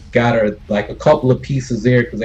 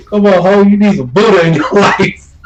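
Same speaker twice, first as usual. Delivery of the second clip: higher, louder sing-song come-on with a rising "ho"; mock bar girl call; 8 semitones higher, 7 dB louder than usual. Repeating the bar girl's pitch to a sailor, high and loud.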